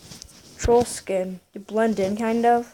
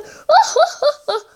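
high, loud put-on laugh; 5 dB louder than usual. Gamer acting out his name LOL, high put-on laugh.

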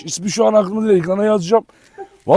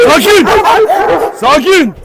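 loud, high "Sakin!" to the dog; sharp command to calm down; loud call to settle the dog; firm calming commands; 9 semitones higher, 4 dB louder, slower than usual. Real, not acted.

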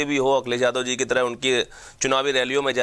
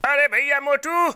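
high squeaky cartoon voice; shrill, excited cartoon character; cartoon kid voice; 10 semitones higher than usual. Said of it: "Motu Patlu, Oggy, इनकी आवाज करता हूँ".

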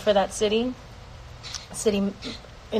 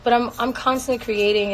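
ordinary talk

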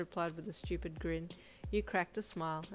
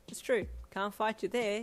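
higher, louder grinning concession; bright grin; 4 semitones higher, 6 dB louder than usual. A narrator's reading. Hawks concedes to his partner with a bright grin. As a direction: give in happily with a grin. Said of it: "he replied with a bright grin".

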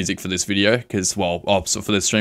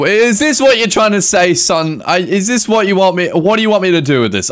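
mock parental scolding; 8 semitones higher, 4 dB louder than usual. Mock scolding a game item.

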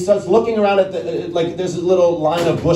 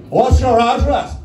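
high, slow, louder caller voice; blunt caller; 8 semitones higher, 4 dB louder, slower than usual. Acted. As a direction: ask it like a drunk guest. Caller asks address.

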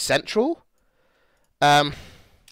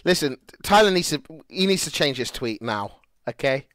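normal talking voice

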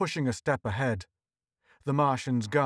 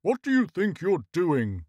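indignant higher-pitched bawl; bawled challenge, pitched up; 4 semitones higher, 3 dB louder than usual. A narrator's reading. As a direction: bawl the question in protest. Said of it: "Horseface bawled".

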